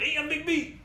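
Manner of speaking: lightly voiced boss greeting; imitating Big B. Imitating the boss who brought pizza, mild Big B voice. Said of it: "he talks like that too".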